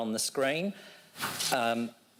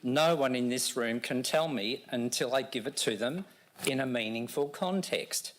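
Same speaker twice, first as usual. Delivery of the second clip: usual speaking voice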